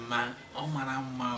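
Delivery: soft, bashful answer to mother; a shy daughter answering softly; shy blushing reply. Acted. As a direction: murmur it, a little embarrassed. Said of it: "So you will respond".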